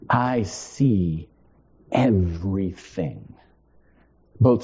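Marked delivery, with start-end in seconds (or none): 0.1-3.3 deep, measured I see everything; low confession. Shown as a demonstration. To the congregation, he declares it low and sure. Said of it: "that was my confession".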